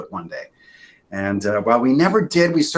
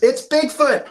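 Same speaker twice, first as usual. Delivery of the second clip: excited call; 5 dB louder, slower than usual. Acted. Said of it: "Ben says".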